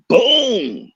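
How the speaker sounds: high loud boom; slam sound effect. Retold or imitated. Teller acting out the coach body slamming the rival, loud boom. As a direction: mimic the crash.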